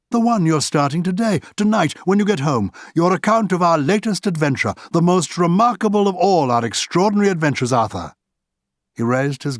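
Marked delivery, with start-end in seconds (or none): 0.1-8.1 higher, expansive and theatrical; lightly voiced Oscar. Oscar toasting Arthur's new book, higher and grandiose. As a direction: sweep him up in the grand idea.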